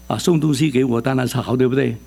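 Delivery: normal talking voice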